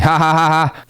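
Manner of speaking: a stiff, joyless "ha, ha" with no face behind it; fake laugh. Imitating a serial killer's empty laugh, hollow and stiff. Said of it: "he's laughing".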